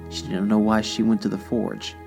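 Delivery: usual speaking voice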